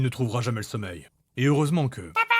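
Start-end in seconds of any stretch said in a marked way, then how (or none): 2.0-2.3 put-on very high squeal of a child; excited child shout. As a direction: shriek with joy like a little girl.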